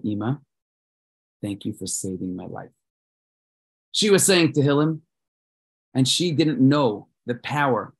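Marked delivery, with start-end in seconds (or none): 0.0-2.7 mild tearful thanks. Acted. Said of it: "And Daniel started crying".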